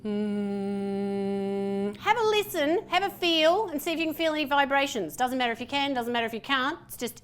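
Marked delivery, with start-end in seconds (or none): 0.0-2.0 low steady closed-lip hum; hummed M. Shown as a demonstration. Coach humming so partners feel vibrations, low hum. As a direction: sink into a long low hum. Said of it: "just making the M sound".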